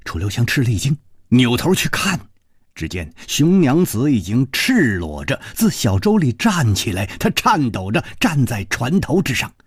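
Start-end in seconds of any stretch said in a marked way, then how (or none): none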